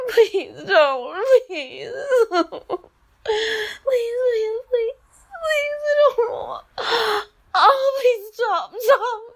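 whimpering pleas between laughs; mock begging. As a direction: beg like you half mean it, breaking into laughter. Begging in fantasy, half laughing.

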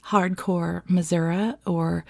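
normal talking voice

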